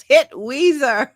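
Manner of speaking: high, loud, drawn-out yell; hammed-up film quote, shouted slowly; movie-quote yell. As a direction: holler it like the movie character. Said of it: "what's her name yells".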